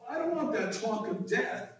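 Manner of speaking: a touch of excited pleading; excited Peter. Acted. Preacher as Peter wanting Jesus to hang around, mildly excited. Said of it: "in his excitement".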